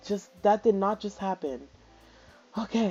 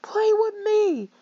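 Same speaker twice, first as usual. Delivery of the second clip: imitating her; 8 semitones higher, 3 dB louder than usual. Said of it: "She said".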